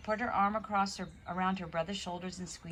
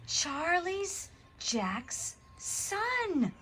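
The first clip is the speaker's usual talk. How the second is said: slow, high gasp of shock; 9 semitones higher, much slower than usual. A narrator's reading. Tom gasping as he reads the letter's signature. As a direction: gasp it out in disbelief. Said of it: "gasped Tom".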